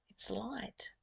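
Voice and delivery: soft unvoiced whisper. Real, not acted. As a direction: breathe it out as a hushed secret. Whispered aside while teaching.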